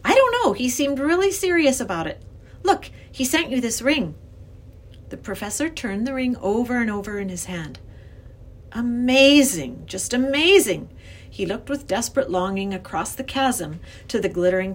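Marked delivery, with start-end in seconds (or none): 0.0-4.1 put-on higher, louder girl character; earnest young girl. A narrator's reading. Lindy's voice. 8.7-10.9 drawn-out wonder, higher and louder; amazed. A narrator's reading. Professor marvels at the ring.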